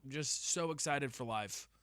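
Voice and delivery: normal talking voice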